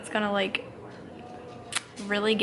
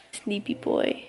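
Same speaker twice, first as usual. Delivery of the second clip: whispering; 3 semitones lower, 5 dB quieter, slower than usual. Real, not acted. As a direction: hush it to the little one. Soft to the baby.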